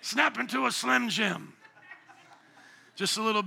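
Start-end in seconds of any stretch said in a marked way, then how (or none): 0.0-1.5 put-on shouty voice at modest volume; mock-shout it like a catchphrase impression; hammed-up, strained impression of a hoarse preacher; Pastor Joe imitation. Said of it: "do a Pastor Joe imitation".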